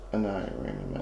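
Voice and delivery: nervous night vlog whisper. Real, not acted. Whispered aside during investigation.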